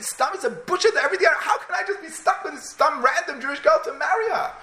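high, stammering, indignant wail; acted tearful protest. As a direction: wail in mock despair at a humiliating match. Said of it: "and he's crying".

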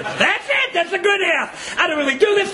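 excited relief. Acted. Excited exchange seeking tenth man.

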